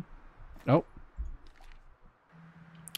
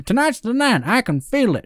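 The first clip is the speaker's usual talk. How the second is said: put-on southern accent; 8 semitones higher than usual. Gamer playing a fisherman sure of tonight, southern accent. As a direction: play it up as a southerner. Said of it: "take on a southern accent".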